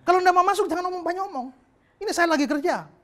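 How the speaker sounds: loud, high, impatient scolding; angry prostitute. Retold or imitated. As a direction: snap at him to come in or leave. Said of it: "pelacurnya marah sama saya".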